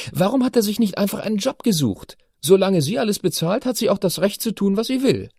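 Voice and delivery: put-on high, insistent girl's voice; teenage daughter, indignant. A narrator's reading. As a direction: argue like a righteous teen. Chiara argues.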